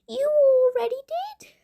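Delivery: high gloating witch voice. A narrator's reading.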